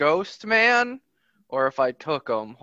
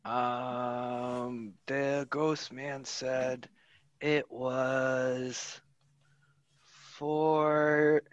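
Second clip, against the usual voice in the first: soft, timid little goblin; small quiet voice; 3 semitones lower, 8 dB quieter, much slower than usual. Acted. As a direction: murmur it shyly. Goblin answers the kneeling orc.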